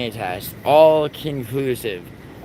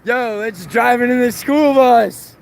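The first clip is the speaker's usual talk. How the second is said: higher, slow, drawn-out hype; repeating the bus idea, first take high and slow; hyped mock quote; 9 semitones higher, 5 dB louder than usual. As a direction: stretch it out like an excited buddy. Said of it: "Some New York ass shit".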